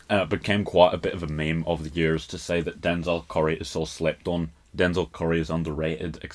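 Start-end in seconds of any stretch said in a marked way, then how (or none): none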